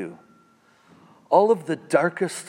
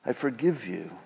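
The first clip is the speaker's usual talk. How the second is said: softer, quiet and gentle; tender forgiveness; 3 semitones lower, 8 dB quieter than usual. Acted. Jesus forgiving the listener, soft and tender. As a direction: say it softly, like a gentle pardon. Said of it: "But the voice of Jesus says".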